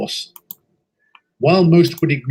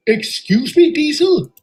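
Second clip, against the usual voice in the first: much higher and louder, taken aback; startled indignant question; 11 semitones higher, 4 dB louder than usual. Acted. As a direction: react as if you misheard. Engine shocked by question.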